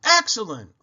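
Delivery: loud, high praise; a ringing shouted cheer. A narrator's reading. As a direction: cheer him on over the music. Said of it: "Uncle Bill shouted".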